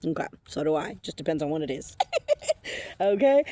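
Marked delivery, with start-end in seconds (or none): 2.0-2.9 laugh. Real, not acted. Laughing at herself.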